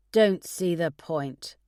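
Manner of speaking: slow sulky grumble, no conviction; belligerent teenager. A narrator's reading. Father refuses physio to his nurse, slow sulky grumble. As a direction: sulk it out like a stubborn teen. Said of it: "like a belligerent teenager".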